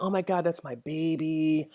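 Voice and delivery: a hint of a swooning Instagram fan; mocking fangirl. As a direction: mimic a gushing fan, drawn out and breathy-soft. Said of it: "chicks that are like".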